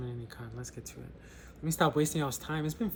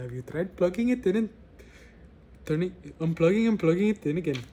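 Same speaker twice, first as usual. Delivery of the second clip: loud, higher put-on Indian accent; hammed-up Indian accent, pitched up and louder; 4 semitones higher, 8 dB louder, faster than usual. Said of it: "an Indian one is coming out".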